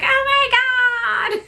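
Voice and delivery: high squeal of disbelief; a high, stunned gasp of words; retold shock. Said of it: "So I'm like".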